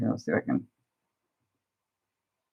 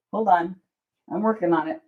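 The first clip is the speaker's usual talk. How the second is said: usual speaking voice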